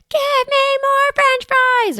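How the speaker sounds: put-on high, slow, whining child demanding fries; mock toddler whine; whiny toddler.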